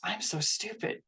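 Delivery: quiet mock self-talk; muttered self-criticism. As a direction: mutter it to yourself under your breath. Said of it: "Challenge those negative thoughts".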